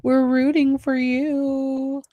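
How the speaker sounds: high, drawn-out theatrical wail; mock dramatic meme quote. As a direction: stretch it out high like a famous catchphrase. Acting out the famous meme line.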